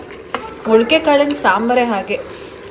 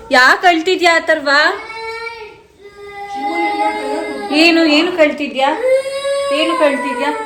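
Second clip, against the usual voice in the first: higher-pitched questions to a little one; raised, sing-out parent voice; 9 semitones higher than usual. Real, not acted. Asking crying child what is wrong.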